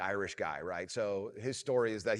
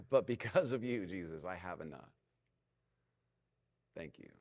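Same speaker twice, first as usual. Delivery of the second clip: gentle grateful prayer; 3 semitones lower than usual. Shown as a demonstration. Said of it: "praying the prayer".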